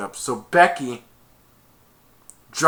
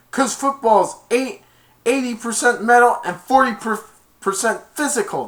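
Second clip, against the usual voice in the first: loud boast; 9 semitones higher, 5 dB louder than usual. Retold or imitated. Zoltek boasting to Murphy, high cocky yell. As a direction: lay out the line like a big shot.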